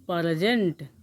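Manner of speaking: प्रेजेंट drawn out with an extra vowel, "pa-ra-jent"; mock mispronunciation. Shown as a demonstration. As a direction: say प्रेजेंट low and slow like a rustic learner, "paara-jent". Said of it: "प्रेजेंट नहीं". (प्रेजेंट का देहाती गलत उच्चारण, "पारजेंट")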